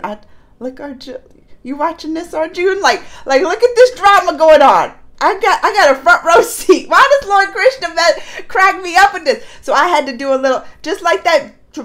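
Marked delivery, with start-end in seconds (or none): 0.0-9.4 amused Krishna. Retold or imitated. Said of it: "And he'll turn to Arjun".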